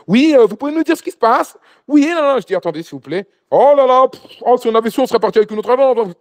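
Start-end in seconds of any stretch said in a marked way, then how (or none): 0.1-2.4 high, loud worried client voice; anxious pilgrims. Retold or imitated. Pilgrims pressing the guide for answers, high and anxious. 3.5-6.1 high, loud exasperated moaning; complaining clients. Retold or imitated. Upset pilgrims regretting the agency, high loud moaning.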